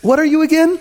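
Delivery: high, loud mocking question; passive-aggressive jab. Father mocking his son's failures, high and snide. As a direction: twist the knife with fake innocence. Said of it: "the passive-aggressive route".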